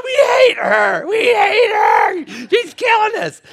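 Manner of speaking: high-pitched drawn-out mock wail; hammed-up whining chorus of fed-up workers; wailing employees. Staff acted out complaining about the manager.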